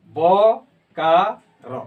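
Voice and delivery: बोकारो drawn out very slowly, Kudmali way; Kudmali pronunciation. Shown as a demonstration. Teacher demonstrating बोकारो slowly to students. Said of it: "ये कुड़माली इसका उच्चारण होगा".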